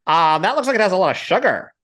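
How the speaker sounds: high-pitched politician impression; mock politician, high and pushed; Ron DeSantis. Shown as a demonstration. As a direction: raise the voice into a caricature. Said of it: "He talks, he talks like".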